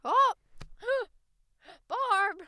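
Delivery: high, loud mock panic with a gasp; hammed-up old lady can't breathe; fake panic. Granny faking a collapse to her friend Barb, high loud mock panic.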